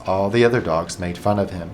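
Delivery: usual speaking voice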